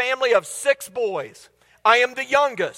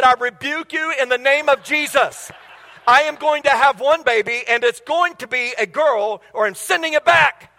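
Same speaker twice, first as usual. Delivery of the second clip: mock indignant mother; 2 semitones higher than usual. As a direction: rebuke it like a fed-up mom. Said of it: "She said".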